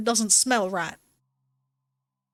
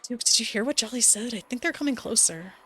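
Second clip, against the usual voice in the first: normal talking voice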